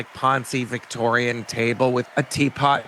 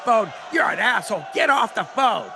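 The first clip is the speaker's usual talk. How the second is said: crowd yelling; 8 semitones higher than usual. Retold or imitated. Audience yells at the fan on his phone, mock shouting. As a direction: bellow it like an angry concert crowd. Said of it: "So all these people start yelling".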